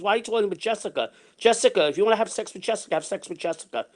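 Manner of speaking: quick imitation of her ranting; jealous rant. Woman accusing him over Jessica, retold rant. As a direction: rattle off her accusations in mock indignation. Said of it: "she went on a rant".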